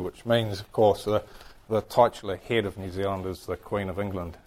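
normal talking voice

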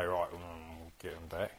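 low, soft, scheming aside, lightly voiced; a hint of a plotting believer muttering revenge; mock vengeful mutter. Teacher imitating a pupil plotting revenge, low mock mutter. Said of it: "You go".

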